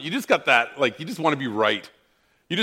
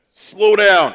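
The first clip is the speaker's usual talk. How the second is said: loud sharp command to slow down; angry shout; 6 semitones higher, 9 dB louder than usual. Retold or imitated. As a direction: bark it like an angry father. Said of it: "It was my angry voice".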